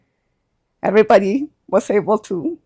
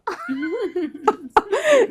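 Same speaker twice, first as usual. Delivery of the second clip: light laugh; 10 semitones higher, 4 dB quieter than usual. Real, not acted. Mother laughs at her own prayer.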